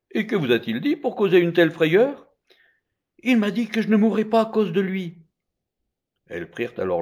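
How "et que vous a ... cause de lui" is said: voiced dialogue. Women question, mother answers.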